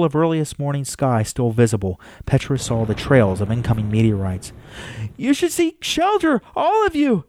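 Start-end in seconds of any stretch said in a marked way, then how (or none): 5.2-7.2 high-pitched call to a crowd; projected warning in a high voice. A narrator's reading. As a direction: urge people above you to safety, voice pitched way up. Trapped, calling up to the crowd.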